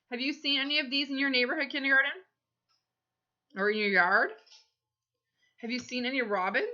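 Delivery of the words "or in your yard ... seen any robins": ordinary delivery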